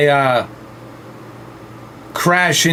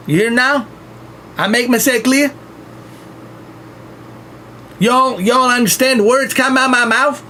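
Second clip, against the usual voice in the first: exaggerated Southern twang, higher pitched; Southern accent; 7 semitones higher than usual. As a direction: lay down the law like a mountain moonshiner. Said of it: "Stevie Southern accent".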